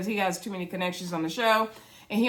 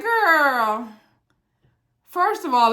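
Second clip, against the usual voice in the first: sassy call-out; 5 semitones higher, 6 dB louder, much slower than usual. Retold or imitated. Reacting to reality show scene.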